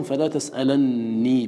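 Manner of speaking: تسألني with a doubled n, tas'alanni; slow, hold the nun in تسألني; تسألني said tas'alanni with stressed double n. Shown as a demonstration. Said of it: "بالتشديد".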